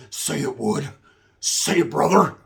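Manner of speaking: hoarse, half-whispered mock voice; rasping urging. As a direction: egg him on in a rasp.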